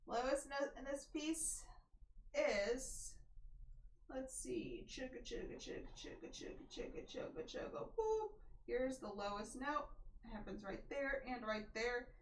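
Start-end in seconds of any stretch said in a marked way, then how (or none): 4.9-7.9 soft, voiceless chugga-chugga sound; chugga filler noise. Real, not acted. Filler noise while scanning the page. 8.0-8.3 short, high-pitched boop; playful boop. Real, not acted. Playful sound on finding the note.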